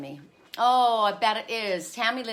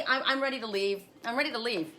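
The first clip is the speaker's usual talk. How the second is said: ordinary delivery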